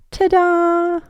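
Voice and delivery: high showman ta-da; magic reveal. Acted. Magic reveal at the party, high and bright. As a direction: announce the trick with flair.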